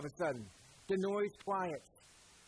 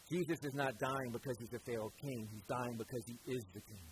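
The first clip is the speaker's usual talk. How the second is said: ordinary talk, nothing marked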